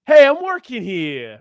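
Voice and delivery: high, loud, drawn-out protest; mock indignant shout. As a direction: holler it slowly in playful outrage. Acting out an indignant quote.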